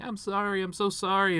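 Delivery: high, wailing imitation of a crying girl; hammed-up sobbing sorry; imitating a weepy cashier; mock bawling apology. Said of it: "she's bawling".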